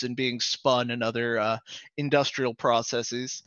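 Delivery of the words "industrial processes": usual speaking voice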